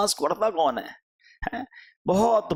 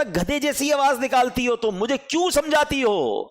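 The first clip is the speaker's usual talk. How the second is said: scornful retort; 9 semitones higher than usual. Retold or imitated. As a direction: sneer back at a nagging mother. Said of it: "ماں کو کہتا تھا".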